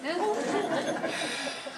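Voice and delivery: short soft chuckle of defeat; rueful laugh. Acted. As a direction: laugh at being volunteered. Husband laughs at wife's scheming.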